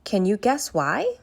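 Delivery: plain reading voice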